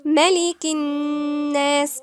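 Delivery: slow recitation on one held note; ghunnah recitation. Shown as a demonstration. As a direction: hum long on the ن of الناس in ملك الناس. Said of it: "في حرف غنة مشدد عند النون".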